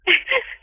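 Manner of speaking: choked sob. Real, not acted. Crying while retelling.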